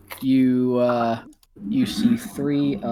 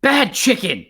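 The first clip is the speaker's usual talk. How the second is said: much higher, loud scolding; bitten goblin yelp; 12 semitones higher, 8 dB louder, slower than usual. Acted. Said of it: "And the goblin says".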